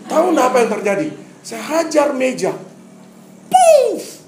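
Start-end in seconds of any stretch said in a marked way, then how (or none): 3.5-4.2 high, loud imitated smash "Puff!"; loud punch sound effect. Preacher recreates punching the desk, loud mock impact. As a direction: blast out the sound of a fist through wood.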